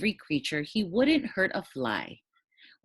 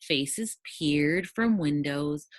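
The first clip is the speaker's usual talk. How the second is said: ordinary delivery, nothing marked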